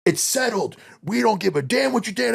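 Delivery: a hint of a smug rude man; mocking a rude neighbour. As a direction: sneer it as the man who took the space. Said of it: "And he was like".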